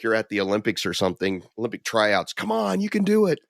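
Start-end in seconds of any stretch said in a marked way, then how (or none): 2.3-3.4 mock cheering. Cheering at Olympic tryouts from the balcony, high mock yell. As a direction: urge on a runner in a mock holler. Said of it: "yelling at, for 'em".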